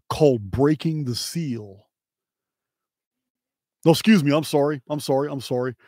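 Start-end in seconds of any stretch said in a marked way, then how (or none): none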